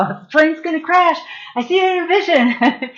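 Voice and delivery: half-laughing alarm, voice shooting up; panicked warning. Retold or imitated. Warning the crew the plane will crash, frantic and high.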